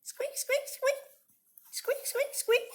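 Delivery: tiny high squeaks; high, thin squeak, squeak, squeak; squeaking egg. Acted. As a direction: squeak like a chick inside the shell. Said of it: "This egg is squeaking".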